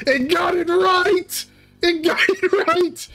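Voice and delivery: high, slow, shouted delight; excited shout. Real, not acted. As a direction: cheer that the AI got it right. Excited AI finally correct.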